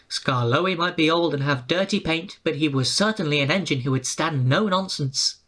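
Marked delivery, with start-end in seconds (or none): none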